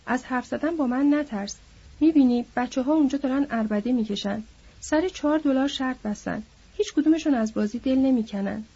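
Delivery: ordinary delivery, nothing marked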